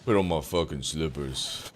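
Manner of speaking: bossy man home from work; deep at-home voice. Retold or imitated. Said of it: "he's like".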